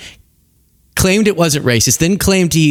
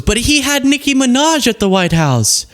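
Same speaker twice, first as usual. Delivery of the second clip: higher, smug mock defense; mocking defender; 9 semitones higher than usual. Host parodying the Trump defenders, mock smug. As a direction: parody the excuse smugly. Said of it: "their original defense was".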